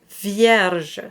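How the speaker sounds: vierge with a barely tapped R; light R flip. Shown as a demonstration. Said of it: "the flip of the R is very light".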